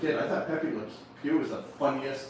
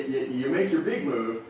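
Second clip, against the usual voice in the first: ordinary talk, nothing marked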